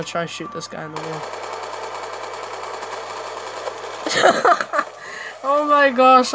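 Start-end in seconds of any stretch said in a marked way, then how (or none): 1.3-5.4 long, high, voiceless laugh; laughing at oneself. Real, not acted. Player missed shooting through wall, helpless laugh. Laughs at own failed shot. 5.4-6.3 loud, raised voice after laughing; exasperated exclamation. Real, not acted. Player kept shooting that wall, amused exasperation.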